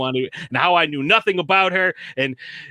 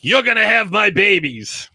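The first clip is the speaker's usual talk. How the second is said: higher and louder, hammed-up come-on; mock macho line; 6 semitones higher, 5 dB louder than usual. Friend retelling a cocky guy's boast, hammed-up. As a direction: play him as a cocky guy boasting. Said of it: "He's like".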